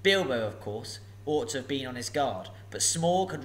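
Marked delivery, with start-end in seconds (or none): none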